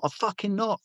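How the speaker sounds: high-pitched mock protest; exasperated denial. Denying to mate he is SAS, exasperated.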